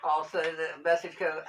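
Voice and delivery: normal talking voice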